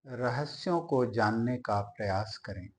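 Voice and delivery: ordinary delivery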